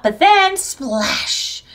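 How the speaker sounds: ordinary delivery, nothing marked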